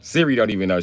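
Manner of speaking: ordinary talk, nothing marked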